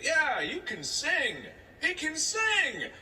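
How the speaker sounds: high, loud, drawn-out showing-off parent; boasting dad. Retold or imitated. Dad showing off his kid at a barbecue, loud and overexcited. Retells dad pushing him to sing.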